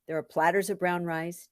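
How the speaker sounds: ordinary delivery, nothing marked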